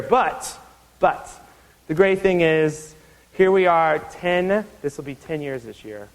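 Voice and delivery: ordinary delivery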